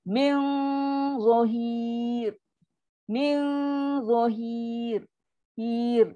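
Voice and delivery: long heavy hum in "Min", thick "za" in "zahir"; heavy "zahir" ending on a thin "Hir". Shown as a demonstration. Teacher demonstrates thick za with thin ending, thick za. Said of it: "dia tu dia akan bunyi tebal".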